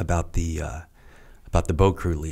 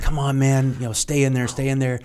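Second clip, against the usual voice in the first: higher, pleading encouragement; egging on a struggling buddy; urging teammate; 7 semitones higher than usual. Retold or imitated. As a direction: cheer him on to stay.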